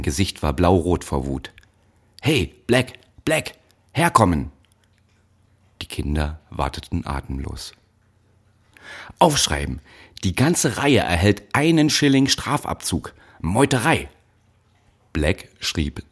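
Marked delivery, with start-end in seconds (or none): 2.2-4.5 higher, louder overseer calling across the hall; barking overseer. Acted. 9.2-14.1 high, loud raging overseer; furious overseer. Acted. Wütender Aufseher verhängt Strafe.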